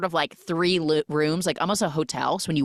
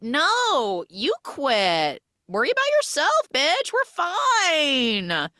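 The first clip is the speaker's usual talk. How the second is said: high, loud, snotty mock brush-off; bratty dismissal; 11 semitones higher, 4 dB louder, much slower than usual. Drunk friends waving off her warning, snotty and high. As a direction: sneer it like a mean-girl friend.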